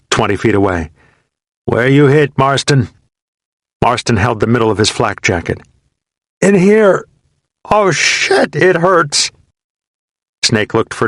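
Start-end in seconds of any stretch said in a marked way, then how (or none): 1.6-2.9 higher, louder call uphill. A narrator's reading. Soldier calling to a hit comrade, loud. 6.4-9.3 pained cry. A narrator's reading. Wounded soldier answering his buddy, crying in pain.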